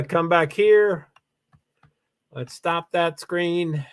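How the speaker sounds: ordinary talk, nothing marked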